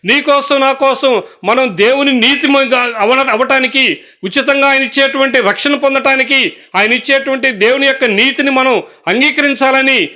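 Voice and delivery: fervent pulpit preaching; preaching at full force. Acted. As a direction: preach it out over the congregation, each line rising. Preaching climax on the curse.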